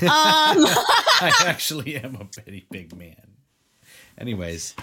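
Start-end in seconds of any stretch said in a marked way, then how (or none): 0.0-3.3 loud, slow protest through laughter; laughing retort. Real, not acted. Co-host answers teasing about being a big man, laughing. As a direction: insist it while cracking up.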